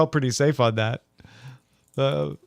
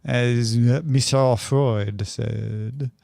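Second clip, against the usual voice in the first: put-on a light, slow, unplaced foreign accent; mock Freud accent, lightly done; vague foreign accent; 3 semitones lower, much slower than usual. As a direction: drag it out in a half-hearted mystery accent. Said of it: "I don't know what accent that is".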